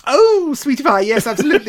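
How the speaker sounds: high, loud delighted squeal; gushing delight. Real, not acted. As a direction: gush in wholehearted agreement. Delighted at the casting suggestion.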